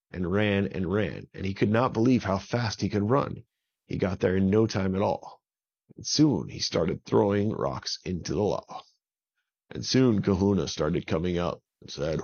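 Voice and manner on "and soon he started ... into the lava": ordinary delivery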